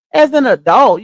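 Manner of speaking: ordinary talk, nothing marked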